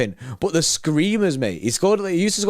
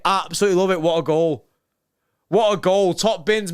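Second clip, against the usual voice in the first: normal talking voice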